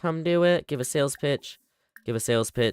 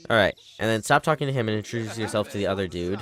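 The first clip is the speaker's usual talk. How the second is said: ordinary talk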